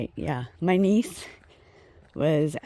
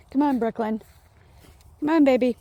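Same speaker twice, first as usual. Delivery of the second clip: high, louder coaxing call to a dog; 10 semitones higher, 5 dB louder, faster than usual. Real, not acted. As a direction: call the dog over to you. Calling the dog while walking.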